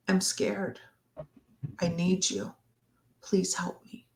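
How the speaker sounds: quiet, pleading, almost whispered; a soft scared plea to a partner; soft vulnerable plea. Shown as a demonstration. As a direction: plead softly for help, exposed.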